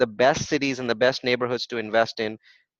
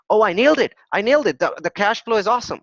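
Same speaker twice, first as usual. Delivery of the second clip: higher and louder, crowing over a great deal; gloating investor; 6 semitones higher, 4 dB louder, faster than usual. Pretend. Speaker mimics a naive Detroit investor, excited and gloating. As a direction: play the smug buyer who thinks he has won. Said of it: "And you're thinking".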